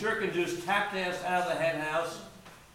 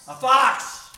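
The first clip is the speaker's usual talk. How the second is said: sudden loud, high exclamation; startled shout of discovery; alarmed cry; 4 semitones higher, 9 dB louder than usual. Acted. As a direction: cry out the danger. Calling out the danger discovered.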